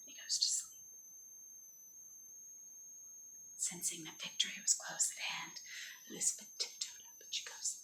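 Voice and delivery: whispered storytelling; hushed narration. A narrator's reading. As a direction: tiptoe with the voice, barely audible. Hushed narration, tiptoeing past sleeper.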